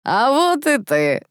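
high, drawn-out tipsy hail; sing-out drunken welcome; merry greeting. A narrator's reading. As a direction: greet cheerily, stretching the words. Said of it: "весело прокомментировал".

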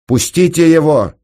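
high, loud sudden cry; cried out. A narrator's reading. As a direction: cry out to make them release him. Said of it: "вскрикнула смерть". (громкий высокий вскрик)

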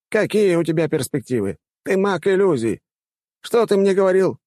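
high, challenging rector voice; indignant old rector. Acted. Rector questioning a graduate on his prospects, challenging. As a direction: grill the pupil in a high-pitched voice.

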